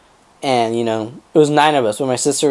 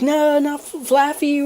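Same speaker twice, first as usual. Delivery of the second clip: high-pitched imitation of a distraught plea; distressed pleading; 12 semitones higher than usual. Sister pleading for the family dog, high and distressed.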